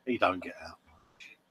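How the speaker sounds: low, soft imitation of the wife; soft quoted wife. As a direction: murmur it like the wife. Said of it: "my missus was like".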